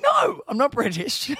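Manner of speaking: high, mock indignant correction; exasperated denial. Host retelling correcting Americans, mock indignant. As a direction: protest it, half laughing. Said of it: "And I'm like".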